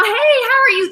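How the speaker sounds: high, chirpy hello; cheery greeting. Retold or imitated. Chasing people's eyes at school with a smile, chirpy greeting. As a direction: greet them eagerly, pitch way up.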